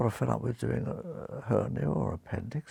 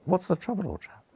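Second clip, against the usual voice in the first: Oxford English, lifted then dropping away; lightly hammed Oxford politeness; doctor's Oxford accent, pitched up at the start; perfect Oxford accent; 5 semitones higher, 3 dB louder than usual. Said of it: "in my perfect Oxford accent".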